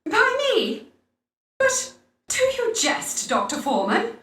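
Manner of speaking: high, shocked and offended; indignant disbelief. Acted. A lady accused of stealing pies, in high indignant disbelief. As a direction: recoil at the accusation.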